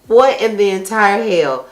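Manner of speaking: usual speaking voice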